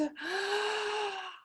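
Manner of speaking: long drawn-in breath; audible deep inhale to steady nerves. A narrator's reading. Ron steadies himself outside the library, deep breath. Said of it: "He took a deep breath".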